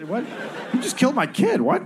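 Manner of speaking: high, laughing mock protest; mock outrage. Cohost voicing the wronged son, high and laughing. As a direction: squeak it out in fake outrage.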